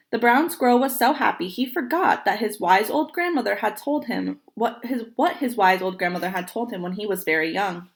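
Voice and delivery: plain reading voice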